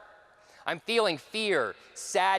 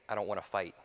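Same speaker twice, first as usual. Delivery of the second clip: low, soft, non-threatening plea; calm refusal; 10 semitones lower, 6 dB quieter, faster than usual. Retold or imitated. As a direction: refuse quietly, hands up in surrender. Calm refusal from meditative pose.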